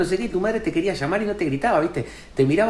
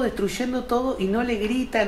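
ordinary talk

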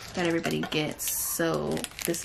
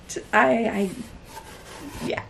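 normal talking voice

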